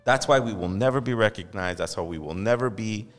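usual speaking voice

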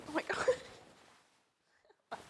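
slow, airy ASMR whisper. Pretend. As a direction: breathe it out like ASMR. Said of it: "Are you doing, like, an A S M R for him?".

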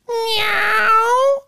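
very high toy cat meow; pull string toy. Pretend. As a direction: squeak it like a toy. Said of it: "that Fisher-Price thing, you pull the string".

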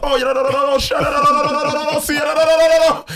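high, loud frantic stream of no's; panicked mother shouting no over and over; acting like my mom. Overwhelmed in worship, imitating her mom's high, loud protest. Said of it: "I'm acting like my mom".

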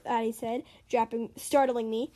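ordinary delivery, nothing marked

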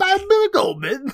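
very high strained screech into laughter; Bobcat Goldthwait voice. Shown as a demonstration. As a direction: shriek it and break up laughing. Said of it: "the Bobcat Goldthwait voice is coming back".

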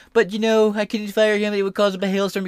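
hammed-up impression of an excuse; mock Trump excuse. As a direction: mimic him whining that he couldn't. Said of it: "Trump couldn't fire back at him".

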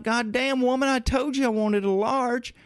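high-pitched exasperated retort; irritated customer yelling at the drive-in speaker, voice high; exasperated outburst. Retold or imitated. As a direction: snap back at the carhop, voice jumping up high. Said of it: "And I'm like".

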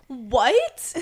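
loud, rising, incredulous question; mom's disbelief. Retold or imitated. Mom reacting to "ain't", mock incredulous. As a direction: imitate a mother's loud disbelief. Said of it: "And my mom's like".

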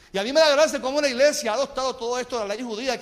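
ordinary talk

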